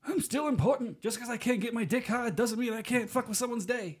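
high mocking old judge; crusty old judge voice. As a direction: sneer it like a bitter old judge. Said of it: "but, like, in a Scottish accent".

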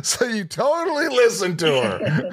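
laughing. Real, not acted. Host laughing at story.

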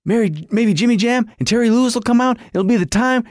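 high, fast fan babble; acting out a giddy fan's rising anticipation; retold excitement. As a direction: race through hopeful guesses, getting carried away. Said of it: "And I'm like".

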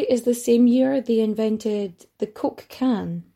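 ordinary talk

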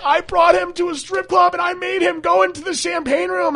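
high, loud mock-tearful mom voice; imitating his crying mom. Mom confessing the strip club trip, high mock wail. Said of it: "and she's like".

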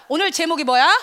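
normal talking voice

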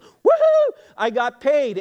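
imitating a high, loud celebratory whoop; excited daughter. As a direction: cheer like a thrilled daughter on payday. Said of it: "there's some excitement".

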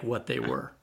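normal talking voice

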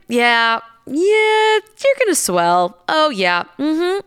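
mock knowing confirmation, pitch swooping up on the yeahs; emphatic confirming. Injector confirming to client that she will swell, emphatic confirming. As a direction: draw out a high, loud yeah as the retold verdict.

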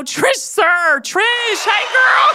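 high, bright call to a friend; host shrieks hello to guest Trish; excited greeting. Real, not acted. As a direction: sing out her name in welcome.

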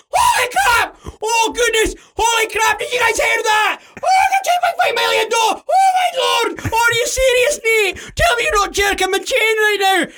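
hammed-up, very high shrieking; over-the-top ecstatic disbelief; excited screaming. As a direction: scream like a fake jackpot winner. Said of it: "I'm all screaming".

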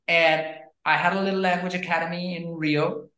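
usual speaking voice

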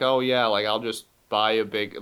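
ordinary delivery

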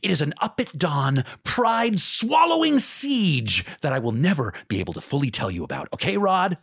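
a light take on the desperate agent; lightly voiced Jerry Maguire. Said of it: "the other one that comes from that is".

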